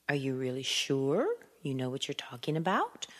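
questioning lifts on "sure" and "about"; rising doubt. Shown as a demonstration. Teacher voicing a listener's doubt, rising on "sure" and "about". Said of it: "going up also means asking a question".

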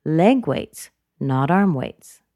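"Leg" and "arm" punched, "weights" falling; slow, emphatic "Leg" versus "arm"; contrastive stress. Shown as a demonstration. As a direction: set "Leg" against "arm". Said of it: "because it's a contrast".

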